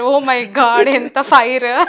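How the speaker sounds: ordinary talk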